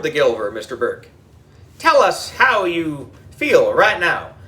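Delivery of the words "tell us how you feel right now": ordinary delivery, nothing marked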